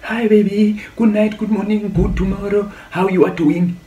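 high-pitched wifey greetings; doting wife act. Retold or imitated. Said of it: "Ndabe ndi-actor as a wife".

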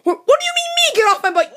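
high-pitched, affronted comeback; squeaky outraged protest; indignant disbelief. Acted. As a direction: throw the order back, incredulous. Indignant at being ordered out.

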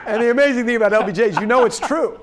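delighted laughing praise; laughing while talking. Real, not acted. Moderator laughing, praising the LBJ story.

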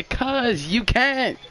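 high and very slow, drawn out; mocking dismissal. Friend retelling the seat refusal, drawn-out high taunt. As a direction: stretch it out high like a brush-off. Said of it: "He said".

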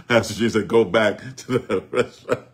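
amused, low voice broken by laughter; laughing. Real, not acted. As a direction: talk through a chuckle. Joking about bad food.

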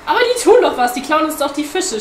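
high, loud protest; agitated, high-pitched outburst; shouting. A narrator's reading. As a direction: yell back in an argument. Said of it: "schrie der Pinguin".

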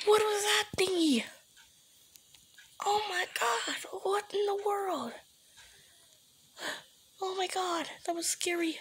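high, breathy frightened whisper; scared hushed voice; shaken whisper. Real, not acted. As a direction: whisper in disbelief.

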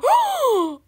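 high, loud gasp of amazement; overjoyed gasp. Pretend. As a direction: gasp in hammed-up delight. Overjoyed at pets in trade offer.

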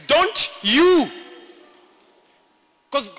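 ordinary talk, nothing marked

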